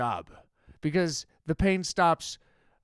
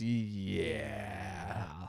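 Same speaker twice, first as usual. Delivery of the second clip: barely voiced, much softer drawn-out yeah; dazed whispered yeah; 9 semitones lower, 12 dB quieter than usual. Drugged phone clerk answering a simple question, whispered. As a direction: let the yeah drift out on breath. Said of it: "And he goes".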